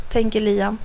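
usual speaking voice

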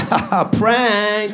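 put-on boy gloating at the villagers; smug prank. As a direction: drag out the gotcha. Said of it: "han bara".

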